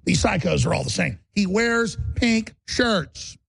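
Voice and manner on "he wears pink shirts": very slow, drawn-out warning; slow creeping warning; slow ominous emphasis. Real, not acted. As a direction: spell it out like a dire clue. Ominous emphasis on psycho sign.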